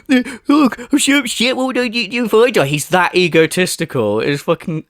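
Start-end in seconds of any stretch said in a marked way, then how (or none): 0.1-2.7 high-pitched blubbering imitation; mock upset. Mimicking him fretting over his own death, mock upset. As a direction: whimper it in exaggerated mock self-pity.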